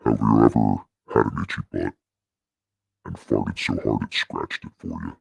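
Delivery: deep voice effect. Shown as a demonstration. Streamer asking Mike a silly question, deep effect voice. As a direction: joke in a deep processed voice. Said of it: "have to go back to this for this".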